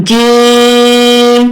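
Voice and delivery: "جیم" stretched very slowly over several beats; elongated letter. Shown as a demonstration. Teacher showing students how far to stretch "جیم". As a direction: draw out "جیم" long and steady. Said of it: "تین سے چار حرکت کھینچ سکتے ہیں".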